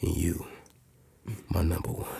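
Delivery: low breathy whisper; whispered dedication. Pretend. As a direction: whisper it like a love song intro.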